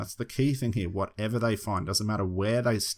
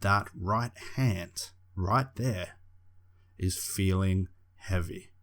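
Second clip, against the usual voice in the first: slow, drawn-out hypnotic tone; slow hypnotist voice; slower than usual. Shown as a demonstration. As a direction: ease them into trance, slowly. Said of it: "I wanna put my hypnotic voice on. I'm gonna slow my voice down and I'm gonna drop down a couple of tones".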